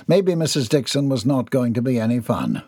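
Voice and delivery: plain reading voice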